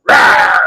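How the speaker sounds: low growled rawr; a short hammed-up bear roar; mock bear roar. Bear roars in joke.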